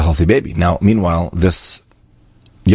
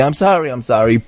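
rote mock apology; 6 semitones higher than usual.

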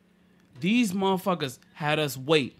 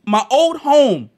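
exasperated retold rant; 7 semitones higher, 8 dB louder than usual. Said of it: "I'm like".

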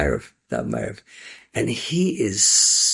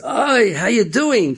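high, loud surprised welcome; delighted greeting; 13 semitones higher, 5 dB louder, much slower than usual. Acted. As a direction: greet him with glad surprise. Rebbe greets former student.

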